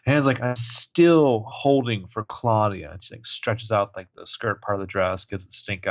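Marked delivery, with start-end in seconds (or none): 0.4-2.9 slow annoyed. Retold or imitated. Hannah annoyed on hold.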